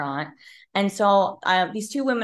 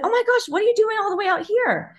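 much higher, bright surprised exclamation; sudden excited tone; 12 semitones higher, 3 dB louder than usual. Retold or imitated. Stranger learns she went to Clemson, voiced in high excited surprise. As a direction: switch to delighted surprise mid-conversation. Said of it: "her tone just completely changed".

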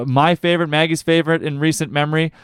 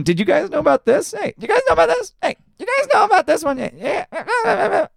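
much higher, put-on late-night host voice with a laugh; Jay Leno. Podcast host tells listeners a topical joke, mock Jay Leno voice. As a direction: ham up a talk-show host teasing the crowd. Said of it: "Jay Leno impression".

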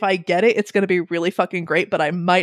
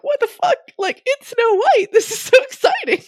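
excited squeal. Retold or imitated. Excited fan reaction, retold.

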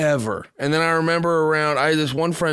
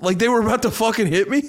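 ordinary talk

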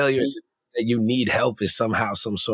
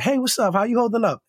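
much higher, bright-voiced check-in from a caring mama; friendly greeting; 10 semitones higher than usual. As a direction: greet him like an old friend, pitched way up. Irvine greets him warmly.

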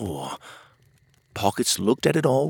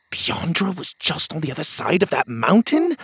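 plain reading voice

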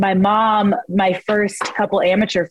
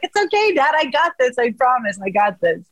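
reassuring dad; 6 semitones higher than usual. Retold or imitated. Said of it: "it's like".

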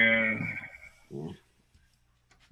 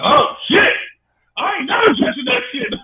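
excited; 5 dB louder than usual. Retold or imitated. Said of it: "customers were like".

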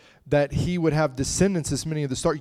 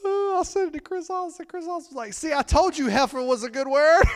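laughing; 13 semitones higher than usual. Real, not acted. Laughing retelling.

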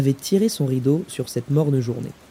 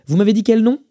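raised, higher manager's question; loud, pitched-up query; calling out a question; 8 semitones higher, 6 dB louder than usual. Acted. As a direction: ask loudly across the counter. Manager asking over the counter.

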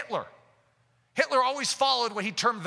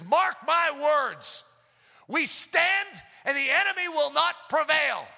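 slow, loud and much higher, every word hammered; defiant proclamation; 8 semitones higher, 4 dB louder, slower than usual. Retold or imitated. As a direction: proclaim it like a prophet before his people. Preacher quoted defying the enemy.

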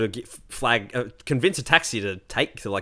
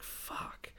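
a breathy whispered fuck, under the breath; whispered curse; 21 dB quieter than usual. Retold or imitated. After his friend says he vanished, whispered curse. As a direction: hiss it out, defeated. Reacting to being abandoned.

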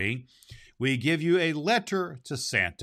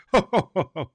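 put-on merry child laugh; 8 semitones higher, 7 dB louder than usual. A narrator's reading. Narrator voices boy's laughter.

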